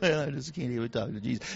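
low, soft mock defeat; mock dejected. Beggar too ashamed to talk to Jesus, mock dejection. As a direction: give up meekly, dropping the voice. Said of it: "Kinda hung his head".

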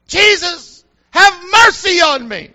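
screaming out, high and very loud; Bartimaeus. Retold or imitated. Blind beggar screaming out to Jesus for mercy, loud plea. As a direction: cry out desperately for mercy at full voice. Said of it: "Bartimaeus is screaming out".

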